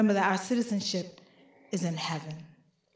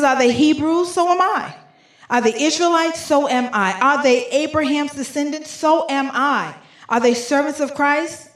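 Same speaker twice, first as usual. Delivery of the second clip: higher and louder, each question punched out; fiery pulpit reading of rhetorical questions; emphatic preaching; 6 semitones higher, 6 dB louder than usual. Acted. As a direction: challenge the crowd, question by question. Preacher reads Paul's boasting questions.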